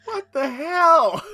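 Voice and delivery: high, slow disbelieving cry; incredulous. Real, not acted. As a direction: sound stunned by the absurdity. Reacting to absurd panel.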